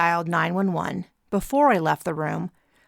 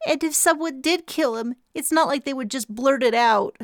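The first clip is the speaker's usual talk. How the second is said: higher, stuffy-nosed after crying; clogged nose; 7 semitones higher than usual. A narrator's reading. Braces answering the group, stuffy nose. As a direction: speak through a blocked nose. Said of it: "With a slightly clogged nose".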